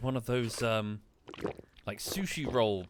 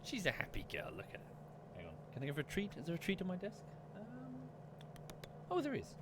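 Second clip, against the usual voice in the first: pet talk; 8 semitones higher, 9 dB quieter, faster than usual. Real, not acted. Chatting to dog, treat.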